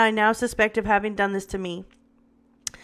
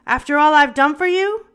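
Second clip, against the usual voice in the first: louder, higher seething question; 5 semitones higher, 6 dB louder than usual. A narrator's reading. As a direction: spit out the betrayal. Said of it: "He seethed as he queried".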